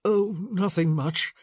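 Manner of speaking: croaky sick voice; croaking. Acted. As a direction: croak it out weakly. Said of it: "he croaked".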